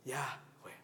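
"Yahweh" as pure breath, whispered; "Yahweh" unvoiced, like inhaling and exhaling; breathed pronunciation. Shown as a demonstration. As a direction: breathe out "Yahweh" in two airy syllables. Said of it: "Si la pronunciamos correctamente".

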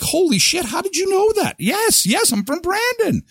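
high, astonished exclamation; stunned amazement. Retold or imitated. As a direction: gasp it out, nearly falling over. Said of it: "I nearly fell over".

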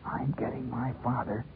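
put-on secretive, hushed boy's hiss; hoarse conspiratorial whisper. A narrator's reading. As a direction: whisper it as if guarding a big secret. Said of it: "leaned into the wind and hissed".

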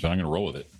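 normal talking voice

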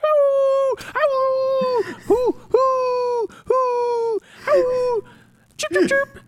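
high howls, hoots and chirps; mimicking owls, coyotes and birds together; forest chorus. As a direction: do the whole forest at once. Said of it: "every bird wakes up and begins".

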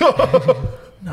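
mock protest, laughing; laughing denial. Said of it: "He's like".

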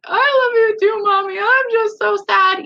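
put-on high, loud, tearful little-girl voice; child falling into mom's arms, crying. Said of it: "she just started bawling".

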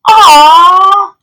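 high, loud, drawn-out "aw"; children cooing happily in chorus, high and loud; happy cry. A narrator's reading. Children seeing the tiny pet, crying out happily. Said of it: "they cried out happily".

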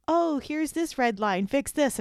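high, slow, prissy know-it-all software voice; mock autocorrect voice. Speaker mocking her autocorrect's nagging in a high, slow voice. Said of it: "autocorrect would pop up and be like".

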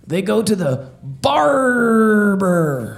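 slow hammy pirate yell; pirate punchline. Acted. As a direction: drag it out, pirate style.

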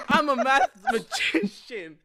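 loud, slow words cracking into laughter; can barely say it for laughing; mock-proud boast breaking into laughs. Proud wrong answer, laughing.

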